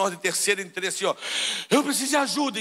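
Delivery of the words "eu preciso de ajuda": high-pitched cry for help; panicked plea. Retold or imitated. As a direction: plead urgently, acting out panic. Acts out panicked plea at hospital. (pedido de socorro encenado, agudo)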